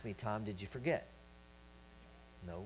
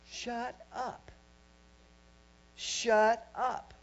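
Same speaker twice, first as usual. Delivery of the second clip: firm, raised-pitch "shut up", held back from yelling; restrained command; 8 semitones higher, 4 dB louder, much slower than usual. Retold or imitated. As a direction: tell them off while still being nice. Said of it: "I'm being nice with you right now".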